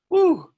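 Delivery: loud, high whooped "whew"; overheated whew. Real, not acted. Instructor recalling the Alabama humidity, loud high whew. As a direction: whoop it out, overcome by the heat.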